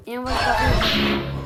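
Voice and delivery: loud urgent call while playing; loud, urgent gamer shout; panicking gamer. Real, not acted. Excited during escape.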